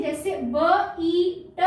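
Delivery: usual speaking voice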